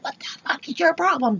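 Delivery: high, fast, mock-outraged squeak; indignant outburst. Teller replays going off at the man, indignant.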